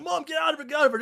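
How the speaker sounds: high, hurried plea to mom; imitated frantic son; mock urgent warning. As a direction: act out yelling at mom to get clear. Son warning mother in scene.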